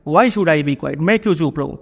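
ordinary delivery

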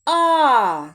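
final А called out loud; loud teacher's model vowel; isolated vowel А. Shown as a demonstration. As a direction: sing out the last А. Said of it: "І останній звук машина".

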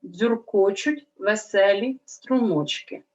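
slow reading aloud. Shown as a demonstration. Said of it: "Прочитаємо речення".

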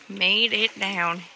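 slow, loud "Made it down"; drawn-out emphasis. Real, not acted. Vlogger repeats her arrival for viewers, slow emphatic "Made it down". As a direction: stretch out each word of "Made it down".

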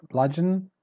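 "bludgeon" with a high first syllable; strong start. Shown as a demonstration. Teacher repeating the word for learners, "bludgeon" stressed up front. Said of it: "start strong and then you go down".

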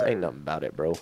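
low, soft mutter to oneself; quiet pep talk. Real, not acted. Player steadying himself before a jump, quiet pep talk. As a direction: say it low and under your breath.

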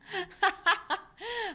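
high-pitched burst of laughter; real laugh. Real, not acted. Teacher tickled by a comment on her hair, laughing. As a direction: crack up helplessly.

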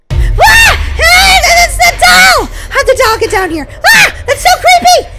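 high, loud screams of fright; terrified screaming. Acted. Girl finding the doll downstairs, high terrified screaming.

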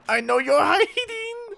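high, slow, giggling through the words; sing-song taunt breaking into a laugh; teasing a hidden enemy, laughing at him. Acted.

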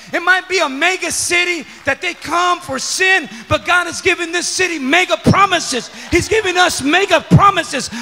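loud, high, rallying preacher's voice; full-force preaching. Real, not acted. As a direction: drive each phrase at the congregation, building to a shout.

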